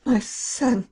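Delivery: grief-stricken call, pitched right up; anguished cry. A narrator's reading. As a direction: cry out for a lost child. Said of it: "she cried".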